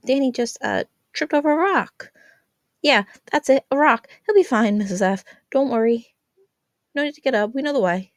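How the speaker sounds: put-on teen covering for Danny to his mom; fumbling excuse. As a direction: rush the lie and wave her off. Friends making excuses at door.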